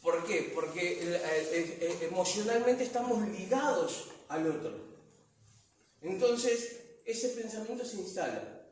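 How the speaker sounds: normal talking voice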